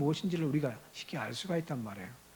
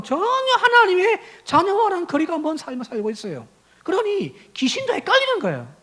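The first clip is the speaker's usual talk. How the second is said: louder, higher sermon delivery; impassioned preaching; 7 semitones higher, 7 dB louder than usual. Real, not acted. Preacher rebuking worldly churchgoers, impassioned and raised. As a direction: press the rebuke home with rising force.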